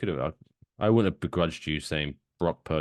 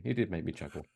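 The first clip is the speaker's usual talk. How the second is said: ordinary talk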